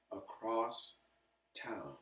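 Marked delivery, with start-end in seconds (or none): none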